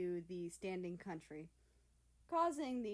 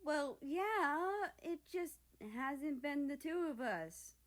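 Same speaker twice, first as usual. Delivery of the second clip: pouting; 7 semitones higher, 3 dB louder, slower than usual. Acted. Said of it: "Japan pouted".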